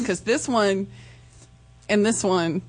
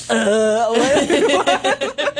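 laughing mock confusion; 3 semitones higher than usual. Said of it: "and I'm like".

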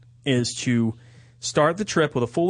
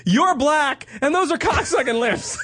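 mock quote; 10 semitones higher, slower than usual. Quotes Ben's imagined line.

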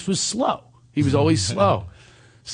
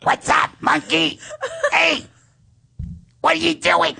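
very high, squeaky buddy voice; monkey voice; 13 semitones higher than usual. Acted. Talking to his friend Munchak in their monkey voice. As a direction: chatter like a cartoon monkey. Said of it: "I don't know where this voice comes from".

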